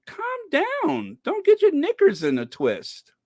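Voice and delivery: put-on high-pitched scolding in own accent; mock exasperation. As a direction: tell them off in a squeaky, exasperated way. Exasperated advice, own accent first.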